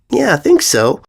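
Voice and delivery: put-on high, reassuring girl; young filly voice. A narrator's reading. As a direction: answer brightly like a child. Apple Bloom answers.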